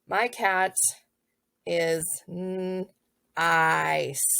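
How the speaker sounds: slow, spaced out with long gaps; drawn-out dictation. Shown as a demonstration. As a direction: let each word hang. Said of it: "they know that my".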